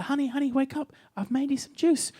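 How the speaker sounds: hushed, tender singsong; gentle wake-up. Acted. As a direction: coax a sleeping partner awake softly. Boyfriend gently waking hungover girlfriend.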